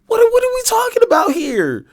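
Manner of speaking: high, fast incredulous question; can't believe what he's hearing; baffled outburst. Real, not acted. Streamer baffled by guest.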